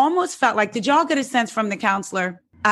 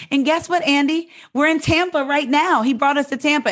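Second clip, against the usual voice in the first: high-pitched, perky retelling of her news; imitated announcement; 7 semitones higher than usual. Retelling her announcement on live show.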